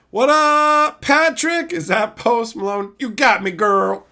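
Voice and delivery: high, loud hammed-up greeting; playful shouting. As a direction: holler it like an over-hyped hello.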